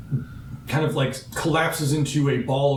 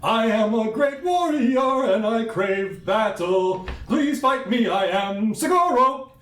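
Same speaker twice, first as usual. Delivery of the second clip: high, slow, grand warrior voice; booming warrior challenge; 9 semitones higher, slower than usual. Acted. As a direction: proclaim it like a proud duelist.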